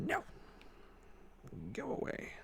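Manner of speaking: breathy high whispered go away; the cat dismissing the owner in a high whisper; whispered shoo. Pretend. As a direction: hiss it out like a snooty cat.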